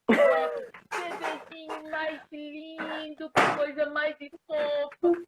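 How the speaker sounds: put-on high, slow, gushing baby talk. Adult cooing over a baby, high and slow. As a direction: coo over the cutest baby. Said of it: "Quem conversa com criança, com bebezinho".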